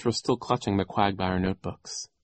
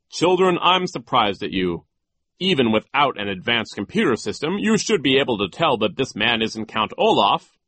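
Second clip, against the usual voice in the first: louder, higher scolding character voice; reproving banker; 4 semitones higher, 6 dB louder than usual. A narrator's reading. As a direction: lecture the children in mild exasperation. Mr. Poe lectures the children.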